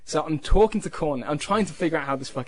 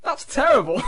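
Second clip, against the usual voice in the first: laughing; 7 semitones higher, 5 dB louder than usual. Retold or imitated. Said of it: "I said".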